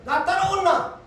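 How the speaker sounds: lightly voiced Jesus calling in broad Gyeongsang dialect. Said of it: "주님이 그러잖아요".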